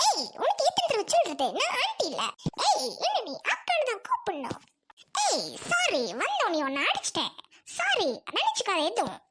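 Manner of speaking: exaggerated swooping put-on voice; vain, huffy caricature of a neighbour; touchy dance teacher. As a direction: scold in a sing-song with big pitch swings.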